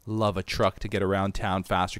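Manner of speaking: ordinary talk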